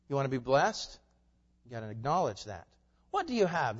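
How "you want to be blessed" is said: ordinary talk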